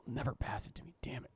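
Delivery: soft whispered grumble; quiet dejected whisper; whispered worry. Retold or imitated. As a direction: whisper it sulkily to yourself. Inner monologue whispered.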